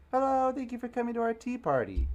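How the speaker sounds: put-on high, loud little-girl voice; twins' voice. Twin girls welcoming guests to tea, high and sweet. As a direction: chirp it like a polite small girl. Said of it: "they're both gonna get the same voice".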